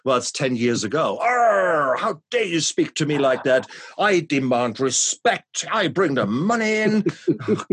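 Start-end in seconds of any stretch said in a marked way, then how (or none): 1.2-7.0 high, loud blustering bully; pompous tyrant voice. Retold or imitated. Mocking his old domineering self. 7.1-7.7 laughing. Real, not acted. Laughs at own impression.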